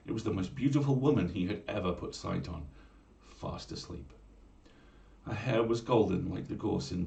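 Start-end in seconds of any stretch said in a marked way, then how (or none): 3.2-4.1 hushed whisper. A narrator's reading. Narrator hushing over the sleeping mermaid, whispered. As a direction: breathe it like she might wake.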